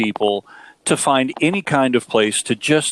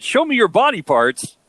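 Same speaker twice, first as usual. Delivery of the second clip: put-on misheard thick-accent version, pitched higher; quicker, higher, with BOARDING PASS blurred into BODY PARTS by accent; 8 semitones higher than usual. Husband quoting what he misheard, thick accent. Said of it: "He had such a thick accent, I thought he said".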